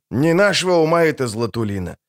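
plain reading voice

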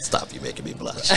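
giggling through the words; laughing. Real, not acted. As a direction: laugh while teasing back. Joking after compliment, laughing.

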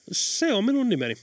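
lightly voiced cockney twist on Finnish; East London accent. Tom Tom tells Late his name in an East London accent. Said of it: "vääntäen äidinkieltään Lontoon itäosien aksentilla".

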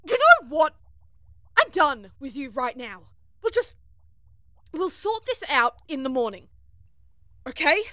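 very loud, high and exasperated; fed-up anger. Acted. Crewmate puts quarrel off till morning, fed-up anger.

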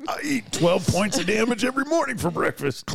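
high, put-on voice for a boast; mock tough boast. Player shrugging off twelve damage, high mock-boastful voice. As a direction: brag it in a silly high voice.

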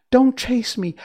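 high, loud, frightened plea; frightened little voice. Acted. China princess crying out as Dorothy runs after her, frightened. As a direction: beg them not to chase you. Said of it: "She had such a frightened little voice".

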